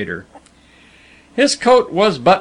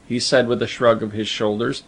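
usual speaking voice

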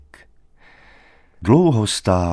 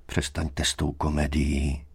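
low, soft rebuke; strikingly quiet; 4 semitones lower, 6 dB quieter than usual. A narrator's reading. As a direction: shut it down without raising your voice. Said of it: "řekl K nápadně tiše". (nápadně tiše a klidně)

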